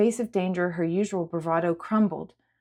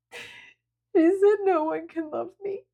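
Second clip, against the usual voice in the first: high, slow, tearful whimper; sobbing; 13 semitones higher, slower than usual. A narrator's reading. Sierra confessing her fear to Julia, sobbing. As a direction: let the words break through sobs. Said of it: "Sierra's shoulders shook again with sobs".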